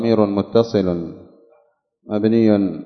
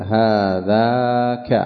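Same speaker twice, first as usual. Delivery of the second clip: slow demonstration; much slower than usual. Shown as a demonstration. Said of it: "فتقول".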